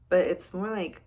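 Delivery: ordinary talk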